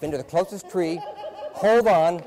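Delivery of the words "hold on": loud, high, sudden outburst; emphatic shout. Retold or imitated. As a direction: shout it as if clinging on hard. Acts out clinging to a tree.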